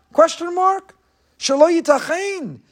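high-pitched disbelief; high, astonished objection; incredulous questioning. Acted. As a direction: pitch it way up, as if it cannot be. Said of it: "He said it as a question".

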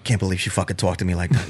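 low, fast grumble under the breath; audible mumble. Retold or imitated. Boyfriend grumbles about the server, low fast mumble. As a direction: mutter it just loud enough to be heard. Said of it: "that mumble, but then you do it loud enough".

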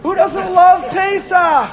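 high, loud, slow exultant cry; excited shout. Real, not acted. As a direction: shout it out, savouring every word. Rhetorical question to audience.

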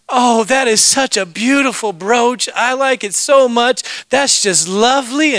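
high, slow, admiring coo; drawn-out, lilting praise; gushing compliment. Retold or imitated. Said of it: "she was just complimenting her".

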